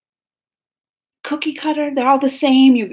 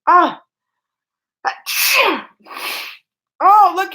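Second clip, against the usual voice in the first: about to sneeze; 8 semitones higher, 4 dB louder, slower than usual. Real, not acted. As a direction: sneeze coming on. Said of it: "I feel like I'm gonna sneeze".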